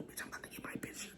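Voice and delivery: fast hushed thought; eager whisper. Retold or imitated. In the bathroom thinking she'd get a photo, eager whisper. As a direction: whisper it to yourself, rushing. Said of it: "because I was like".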